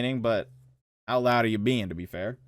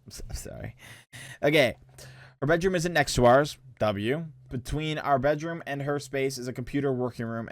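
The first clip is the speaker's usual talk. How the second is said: normal talking voice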